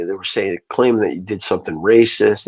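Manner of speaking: ordinary talk, nothing marked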